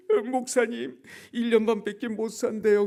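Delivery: high, mock tearful blurting; imitated crying. Staffer breaking the diagnosis to his pastor, imitated high crying. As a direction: blurt it out in a pretend wail. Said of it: "그러면서 막 우는 거예요".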